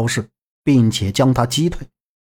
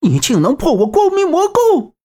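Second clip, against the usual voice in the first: shocked outcry; 13 semitones higher than usual. A narrator's reading. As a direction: cry out much higher in disbelief. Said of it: "失声道".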